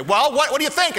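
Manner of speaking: loud, high challenge; demanding coach. Retold or imitated. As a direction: demand an answer.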